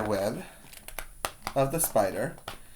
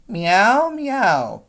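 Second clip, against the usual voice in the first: higher cat meows; meowing cat; 7 semitones higher than usual. A narrator's reading. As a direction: cry out like a cat. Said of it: "cried the cat".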